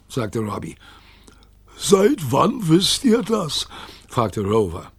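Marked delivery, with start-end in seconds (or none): 1.8-3.7 slow, high, whispery question; aghast dog. A narrator's reading. Rover asking the children, slow aghast whisper. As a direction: gasp it out slowly in a high voice.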